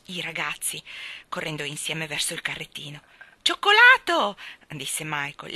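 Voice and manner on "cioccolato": high, loud excited order; calling out. A narrator's reading. Michael orders from the ice-cream man, calling out. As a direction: shout out the flavour.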